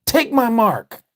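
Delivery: mock Satan announcing. Preacher mocking the idea of Satan announcing himself, high and hammy. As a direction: play a cartoon devil openly naming himself. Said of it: "you think the Antichrist is gonna come and just say".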